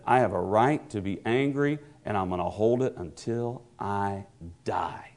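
low, soft bitter vow fading to a whisper; bitter Jonah. Retold or imitated. Preacher voicing Jonah's bitter vow, softening to a whisper. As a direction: hold the grudge close. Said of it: "Jonah said".

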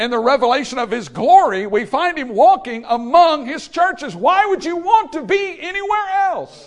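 high, loud, slowed preacher's cry; full-force preaching. Acted. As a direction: proclaim it to the back row with everything.

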